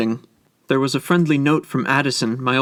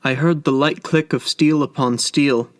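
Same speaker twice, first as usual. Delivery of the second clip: ordinary delivery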